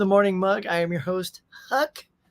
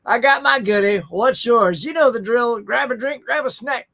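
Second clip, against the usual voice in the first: hyped intro; 4 semitones higher, 3 dB louder, slower than usual. Real, not acted. Show catchphrase intro.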